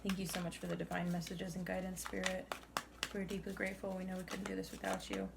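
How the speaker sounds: soft, even prayer of thanks; hushed, reverent thanks; quiet prayer. Real, not acted. Murmured thanks to Spirit.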